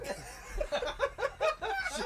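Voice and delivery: helpless high-pitched laughter; high squealing laugh. Real, not acted. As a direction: lose it completely, squeal with laughter. Cracking up mid-story.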